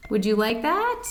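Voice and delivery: ordinary delivery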